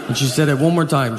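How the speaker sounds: normal talking voice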